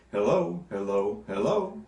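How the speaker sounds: "hello" three times, each stressed on the second syllable; iambic beat. Shown as a demonstration. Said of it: "that is three iambs".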